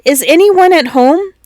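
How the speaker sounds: calling out. A narrator's reading. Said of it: "tapped on the door and cried".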